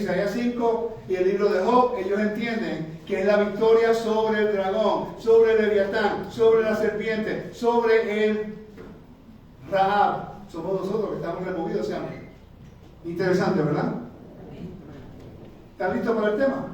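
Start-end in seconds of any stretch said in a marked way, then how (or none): none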